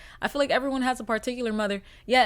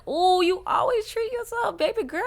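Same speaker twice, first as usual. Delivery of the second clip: mock sweet voice; 9 semitones higher than usual. Mocking inner voice.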